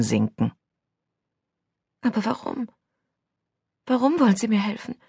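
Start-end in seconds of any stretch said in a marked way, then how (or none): 2.0-4.9 put-on very soft, high girl's voice; hushed, high-pitched disbelief; small, bewildered voice. A narrator's reading. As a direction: ask timidly, barely daring to speak. Wary girl questions stranger's kindness.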